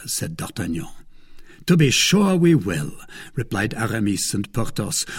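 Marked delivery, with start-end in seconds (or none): 1.6-2.9 higher, louder, ready assent; prompt and decisive. A narrator's reading. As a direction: answer at once, firmly and decidedly. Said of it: "with admirable promptness and decision".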